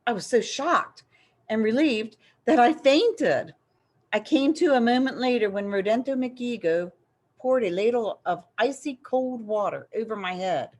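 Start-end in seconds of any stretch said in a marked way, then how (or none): none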